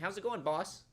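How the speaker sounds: mock-friendly with an edge; derogatory greeting. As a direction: toss out "boss" with a little attitude. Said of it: "Boss is kind of derogatory".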